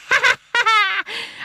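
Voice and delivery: high loud bird cackle; starling cackling at the babies; loud peal of laughter. A narrator's reading. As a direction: roar with mirth. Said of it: "another loud peal of laughter".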